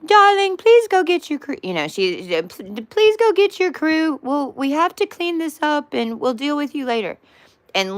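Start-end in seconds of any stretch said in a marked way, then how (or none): 0.0-1.6 very high, louder, sugary mother voice; sweet mother. Retold or imitated. Mom sweetly sending brother to get his crew, very high. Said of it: "But she's doing it". 2.4-7.2 sweet mother. Retold or imitated. Mom sweetly sending brother to get his crew, very high. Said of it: "But she's doing it".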